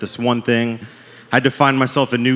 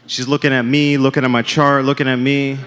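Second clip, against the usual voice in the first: ordinary delivery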